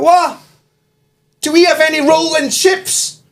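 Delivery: very high, mock bewildered call; slow high holler, acting baffled; confused shouting. Confused counter girl calling to frying guy, high acted shout. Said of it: "And she shouted, completely confused".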